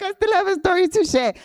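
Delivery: high, bright eager burst; thrilled you can go on; high gleeful excitement; excited. Real, not acted. Relieved, still has time.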